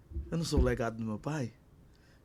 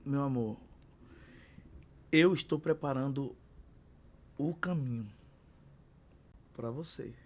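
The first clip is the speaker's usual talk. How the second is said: tender to daughter; 3 dB quieter than usual. Retold or imitated. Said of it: "eu disse".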